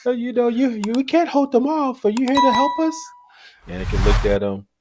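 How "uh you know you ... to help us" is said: high-pitched mock child voice, pleading; little kid. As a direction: imitate a small boy asking for rescue. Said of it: "that kid came out".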